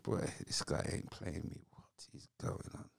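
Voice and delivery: hushed, disbelieving whisper to himself. Retold or imitated. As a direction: mutter it under your breath so nobody hears. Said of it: "I said".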